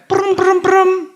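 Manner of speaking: high buzzing ring imitated; phone ringing noise. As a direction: mimic a phone vibrating, high and clipped.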